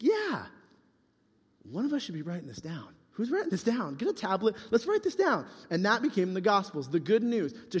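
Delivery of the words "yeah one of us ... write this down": acted eagerness. Acting out eager disciples deciding to write.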